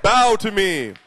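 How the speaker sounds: projected royal command; booming king. Shown as a demonstration. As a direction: give the order in a big kingly voice. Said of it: "the king needs a booming voice".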